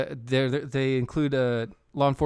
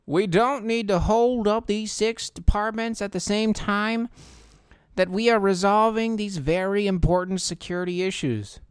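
mock Murkowski voice; 7 semitones higher than usual. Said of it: "Senator Murkowski says, and I quote".

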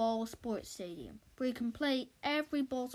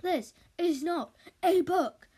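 loud, higher, slow bark; 7 semitones higher, 8 dB louder, slower than usual. Acted. Director barking at Andy through his megaphone, loud. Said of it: "barked Mister Big Shot through his megaphone".

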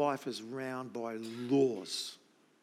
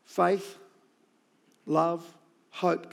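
loud, ringing Faith and love, each word alone; emphatic proclamation; 3 semitones higher, 8 dB louder, much slower than usual. Real, not acted. Preacher to congregation, first loud take, proclaimed. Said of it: "I want to say this again".

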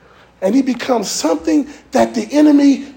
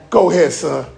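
deep, calm divine command; voice of God; 7 semitones lower than usual. Acted. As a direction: speak as God sending David out. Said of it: "guess what God says to him".

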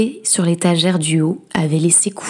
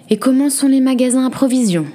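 mildly grumbled question, quick and raised in pitch; grumbling husband; 7 semitones higher, faster than usual. A narrator's reading. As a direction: grumble it lightly, at a brisk pace. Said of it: "grommela-t-il".

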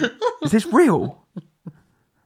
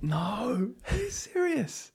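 shocked whisper; 7 semitones higher, 9 dB quieter, slower than usual. Real, not acted. Shocked at the message.

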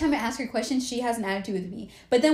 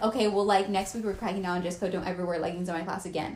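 lower, quieter mock teacher voice; 6 semitones lower, 5 dB quieter than usual. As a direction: mimic a snippy teacher, dropped low. Imitating strict teacher's warning.

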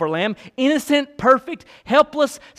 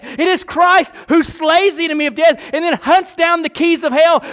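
higher and louder pulpit cadence; full-force preaching; 6 semitones higher, 4 dB louder than usual. Acted. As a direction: preach it with rising fire.

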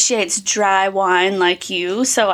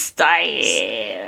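low, slow country-accent "Sarah's Stash"; 12 semitones lower, 3 dB quieter than usual. Shown as a demonstration. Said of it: "my country accent".

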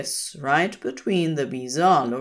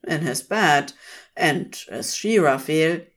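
usual speaking voice

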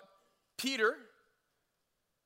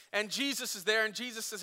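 ordinary talk, nothing marked

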